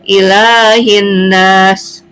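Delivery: very slow "Ilahin nas", no l before nas, a held double n; merged lam. Shown as a demonstration. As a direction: recite "Ilahin nas" in long syllables, lam melted into n. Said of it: "tidak jelas atau dilebur atau diidghamkan".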